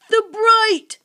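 high, loud creature yelp; cry of pain. A narrator's reading. Sniff recoils from the torch, crying in pain. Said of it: "Sniff cried in pain".

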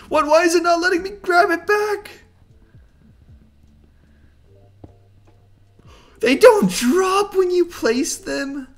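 high, loud outraged whine; shocked disbelief. Real, not acted. Shocked at losing puzzle pieces.

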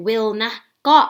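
ordinary talk

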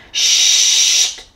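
long sharp shushing hiss. A narrator's reading. Unseen voice silencing the bear, long shush. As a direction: hush someone with one drawn-out breath. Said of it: "fit une voix".

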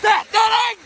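in ice cold water. Real, not acted. Cold shock cry.

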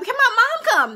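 high, loud desperate plea; panicked pleading. Retold or imitated. Patient refused her husband begs for mother, panicked. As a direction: beg frantically for someone to stay with you. Retold plea while wheeled away.

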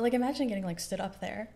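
ordinary talk, nothing marked

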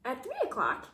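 ordinary delivery

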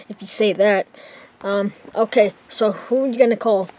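ordinary delivery

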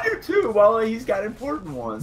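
high, loud mocking imitation; mocking echo. Friend parroting the argument back, high mocking voice. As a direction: shout it back as a hammed-up parody.

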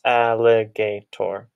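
slow, split into beats al-li-ga-tor; syllables spelled out slowly. Shown as a demonstration. Teacher to class, sounding out "Alligator" slowly. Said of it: "So it has four syllables".

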